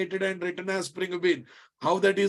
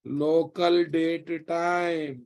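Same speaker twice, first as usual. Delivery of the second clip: very slow, word by word dictation; much slower than usual. Shown as a demonstration. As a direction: spell it out slowly for students. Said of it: "teacher slows phrase for class".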